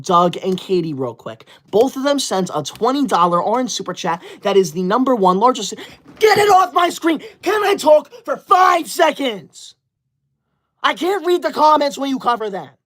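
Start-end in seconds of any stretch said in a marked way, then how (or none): 6.2-12.5 frustrated shout. Real, not acted. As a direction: shout to be allowed to talk. Gift covering chat again.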